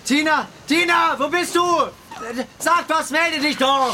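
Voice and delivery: slow, high call into fog. Acted. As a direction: call her name, drawn out. Calling for the lost friend.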